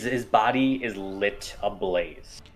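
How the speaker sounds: ordinary talk, nothing marked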